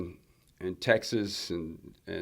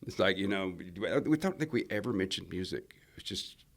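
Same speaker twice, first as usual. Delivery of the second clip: usual speaking voice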